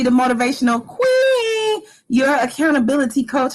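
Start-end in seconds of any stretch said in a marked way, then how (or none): 0.0-1.8 much higher hype cry; hype shout. Acted. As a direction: crown yourself with a shout. Self-hype title shout.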